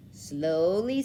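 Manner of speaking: usual speaking voice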